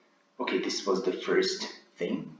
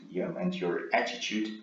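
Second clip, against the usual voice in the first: ordinary talk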